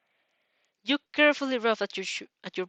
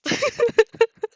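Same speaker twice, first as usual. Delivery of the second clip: loud high cackle; high burst of laughter; 14 semitones higher, 10 dB louder than usual. Real, not acted. Reader guessing the love interest, loud high laugh.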